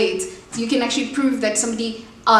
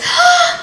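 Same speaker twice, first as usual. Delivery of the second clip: emotional gasp; 10 dB louder than usual. Real, not acted. Overwhelmed, reliving engagement news.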